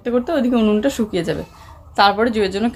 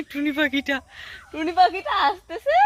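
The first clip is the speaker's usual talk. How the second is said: high, drawn-out sing-song coo; 7 semitones higher than usual. Real, not acted. Mother greets her little bird coming along, playful baby talk.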